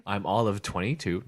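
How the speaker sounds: ordinary delivery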